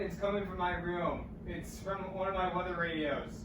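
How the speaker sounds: ordinary talk, nothing marked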